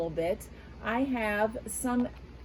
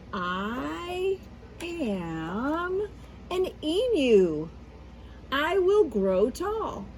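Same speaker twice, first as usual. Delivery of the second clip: put-on reading to children; slower than usual. Teacher reading the emu answer page to kids, slow expressive reveal. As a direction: unveil the answer with storybook drama.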